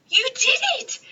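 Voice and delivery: high delighted cheer. Acted. Tiny greeting Penelope at lunch, high cheer. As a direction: cheer her on, proud.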